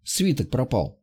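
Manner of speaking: usual speaking voice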